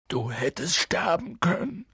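high acted wail; sobbing repetition; tearful. A narrator's reading. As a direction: wail it, overcome with guilt. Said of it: "wiederholte Hagrid".

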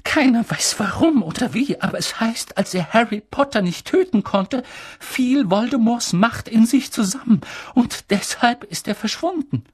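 high, slightly unsteady telling; trembling voice. Acted. Professor passing on rumours of Harry's survival, trembling. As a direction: voice quivering with held-back tears. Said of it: "mit zitternder Stimme".

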